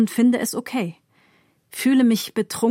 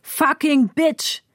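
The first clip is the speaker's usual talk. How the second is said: slow, higher-pitched yell; yelled curse; 6 semitones higher, 4 dB louder, slower than usual. A narrator's reading. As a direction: hurl the insult out, stretching it. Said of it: "brüllt wieder etwas". (langsam gebrüllter Fluch)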